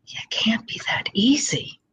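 whispered, a high, stunned "It can't be that easy"; disbelieving whisper. Retold or imitated. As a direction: breathe it out in disbelief.

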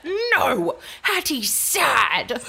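put-on high, loud, slow stubborn toddler; defiant toddler voice. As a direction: mimic a cross little girl stamping her foot. Toddler stamping foot defiantly.

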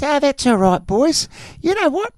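a high, mocking take on a hard-man criminal, climbing up high on the end; Chopper impression. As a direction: act out the tough guy dropping a threat from far up in the voice. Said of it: "doing a voice like this".

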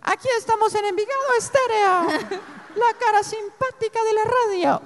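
put-on very high, squeaky boy DJ voice; mimicking his teen self on the radio, high and squeaky; cracking teen radio voice. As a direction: imitate his voice breaking on air. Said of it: "cuando me estaba cambiando la voz".

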